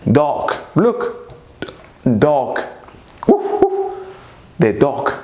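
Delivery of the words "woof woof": high-pitched little woof; dog bark. Pretend. Teacher showing what dogs do, dog bark. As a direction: yap like a dog.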